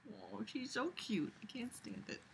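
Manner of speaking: very soft whispered gushing; adoring whisper. Real, not acted. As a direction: whisper it, overcome by cuteness. Gushing over pet while reading.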